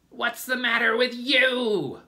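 high, piercing screech; angry screech. A narrator's reading. Aunt Spiker glaring at weeping James, screeching. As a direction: screech it at full force. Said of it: "Aunt Spiker screeched".

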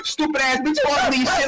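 usual speaking voice

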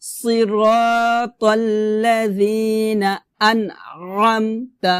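slow tajweed with pressed ain; measured teaching recitation; repeat recitation. Shown as a demonstration. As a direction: recite drawn-out, squeezing the throat on ain. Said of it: "আমি আবার বলি".

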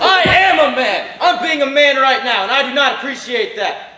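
indignant protest. Acted. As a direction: defend himself hotly. Husband protests in argument.

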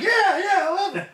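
high, gushing imitation of a partner; mock eager agreement. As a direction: pitch it up, overly delighted. Mimics smiling partner's eager agreement.

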